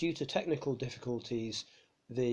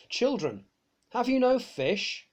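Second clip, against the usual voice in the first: higher, louder and slower, calling out to the boat; raised, drawn-out hail from the shore; calling across water; 11 semitones higher, 5 dB louder than usual. Acted. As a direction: call out over the water from the beach. Jesus calls to boat from shore.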